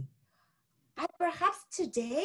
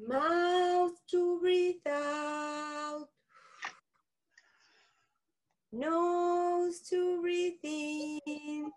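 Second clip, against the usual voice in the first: slow, drawn-out calm instruction; slow breathing guide; much slower than usual. Shown as a demonstration. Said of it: "we need to fill up our lungs".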